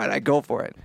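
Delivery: usual speaking voice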